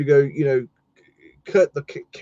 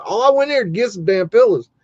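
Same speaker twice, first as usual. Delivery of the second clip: higher, louder frustrated outburst; exasperated; 7 semitones higher, 4 dB louder than usual. Retold or imitated. Shopper ready to check out with his pillows, exasperated. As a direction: burst out in comic frustration.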